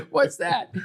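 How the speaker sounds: high-pitched puzzled query; baffled question. Retold or imitated. TV boss asking about an unknown town, baffled question. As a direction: imitate someone completely lost.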